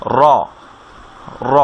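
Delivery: র with a rolled tongue tip; short rolled Arabic র; trilled r. Shown as a demonstration. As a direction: vibrate the tip on র. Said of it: "sound একটু ভাইব্রেশনের সাথে উচ্চারণ হবে".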